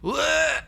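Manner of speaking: sudden high retching cry; pirate retching on the run; retching yelp. Acted. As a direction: blurt out a loud gag as he vomits. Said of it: "you hear him go".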